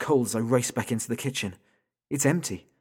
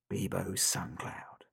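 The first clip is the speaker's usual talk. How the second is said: sinister whisper; 3 semitones lower, 9 dB quieter, much slower than usual. Acted. Sinister caller names victim.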